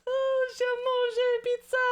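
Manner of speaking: high, hammed-up French accent; mock French accent. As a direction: overdo a French accent for laughs. Joking about bad French.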